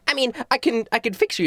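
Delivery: high-pitched coy reply; high showgirl voice; stage magician showman. Acted. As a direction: offer help conditionally in a high voice. Magician replies to angry Trevor.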